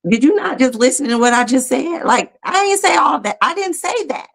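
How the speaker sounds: high-pitched, exasperated disbelief, recreated; retold incredulous protest. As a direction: replay an indignant "that's not what I meant" reaction. Retells own thoughts during hard exercise.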